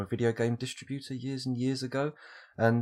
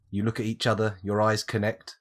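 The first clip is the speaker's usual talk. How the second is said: ordinary talk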